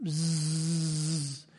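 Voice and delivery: low, soft imitated buzzing of bees; mimicked bee drone. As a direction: hum a quiet buzz like a bee nest. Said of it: "I heard this".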